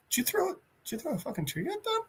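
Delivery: very soft, stunned question; hushed and incredulous, rising at the end; hushed disbelief. Acted. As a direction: ask under your breath, astonished. Stunned Peacemaker questions the gorilla.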